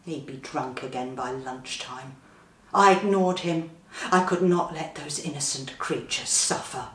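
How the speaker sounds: ordinary delivery, nothing marked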